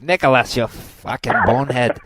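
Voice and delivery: loud, high mock yell from an angry coach dad. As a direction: bark it like a fed-up soccer dad. Dad coach yelling at son.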